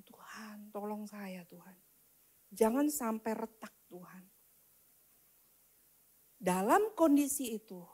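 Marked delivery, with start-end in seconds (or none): 0.1-4.2 low, near-whispered prayer; hushed plea. Retold or imitated. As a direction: plead under your breath to God. Retold desperate prayer after injury. (doa lirih memohon tolong)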